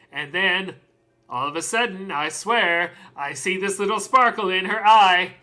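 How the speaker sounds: normal talking voice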